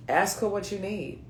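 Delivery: normal talking voice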